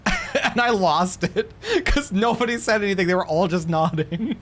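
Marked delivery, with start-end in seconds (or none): 0.1-3.1 high, laughing through the words. Real, not acted. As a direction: crack up while telling the story. Laughs retelling funny game scene.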